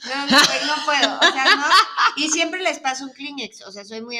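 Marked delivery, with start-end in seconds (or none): 0.1-1.7 loud, half-laughing protest; can't bear it, voice raised; comically exasperated outburst; worked-up irritation. Real, not acted.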